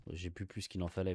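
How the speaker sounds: ordinary delivery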